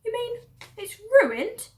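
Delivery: high, slow, loud disappointed cry; dismayed Mabel. Acted. Farm woman hears the tail is ruined, high and slow. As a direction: wail the question, let down.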